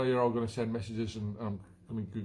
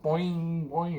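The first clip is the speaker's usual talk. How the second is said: boing; 6 semitones higher, 4 dB louder than usual. Retold or imitated. Imitating clip bouncing off.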